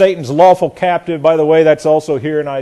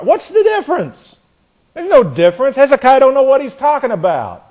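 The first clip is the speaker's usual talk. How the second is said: high, scornful enemy king jeering; mocking taunt; 8 semitones higher than usual. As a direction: sneer at their God like a boastful tyrant. Voicing Sennacherib's mocking taunt.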